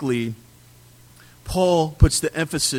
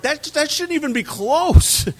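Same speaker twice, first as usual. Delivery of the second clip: higher, outraged protest; indignant Luther; 11 semitones higher, 4 dB louder than usual. Retold or imitated. Preacher voicing Luther rejecting James, mock outrage. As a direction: scoff as if the idea is absurd. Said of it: "Martin Luther was like".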